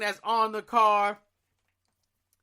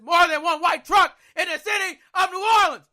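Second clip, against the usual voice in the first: higher, louder, near-cracking frustration; exasperated shout; 8 semitones higher, 6 dB louder than usual. Real, not acted. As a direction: let the frustration burst out. Exasperated at himself.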